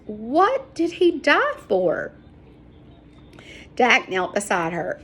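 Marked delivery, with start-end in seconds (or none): 0.1-2.1 slow, high, tearful question; choking up. A narrator's reading. Sarah kneeling by the dead man, choking up. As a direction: let the voice catch on it. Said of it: "choking up as she spoke".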